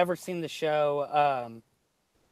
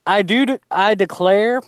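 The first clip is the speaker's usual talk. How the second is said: Southern Belle; 6 semitones higher, 12 dB louder than usual. Shown as a demonstration. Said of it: "you're thinking more of Southern Belle".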